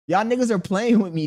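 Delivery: ordinary talk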